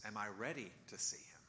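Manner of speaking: hushed, anxious whisper. Retold or imitated. Preacher voicing Peter's doubt to himself, whispered. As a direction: breathe it like a private fear.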